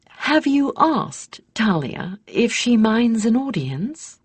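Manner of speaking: high, slow queenly voice, gently probing; a mother-queen asking carefully. A narrator's reading. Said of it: "the Queen asked her transformed offspring".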